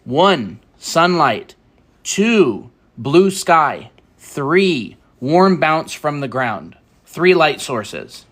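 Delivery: clear, slow explainer; lesson voice. Acted. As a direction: explain it like a teacher. Teaching art stream style.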